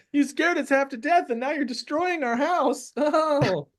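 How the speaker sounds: very high squeaky complaint; scared kobolds. Acted. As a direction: wail in a little creature's falsetto. GM voices frightened kobolds protesting.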